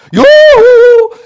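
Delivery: very high, loud yodel-like yoo-hoo; shrieked yoo-hoo from far away; yoo-hoo call. A narrator's reading. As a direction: call across the hills, waving. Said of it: "winkte die Trachtenträchtige".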